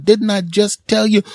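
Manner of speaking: high-pitched exasperated scolding; exasperated parent. Acted. Parent confronting the daycare worker over peanut butter, exasperated. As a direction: act out a parent at the end of their patience.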